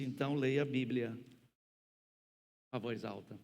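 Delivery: ordinary talk, nothing marked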